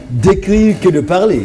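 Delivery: high, drawn-out words breaking into laughter; amused teasing. Real, not acted. Host teasing a guest about writing over speaking, laughing. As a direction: tease gently, laughing as you finish.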